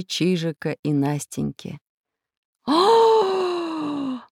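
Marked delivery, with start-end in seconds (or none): 2.7-4.2 high, long falling "aah"; drawn-out exhaled cry. A narrator's reading. Adults falling after the children, long cry. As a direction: let out a long airy wail. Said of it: "Добавился к ним выдох профессора".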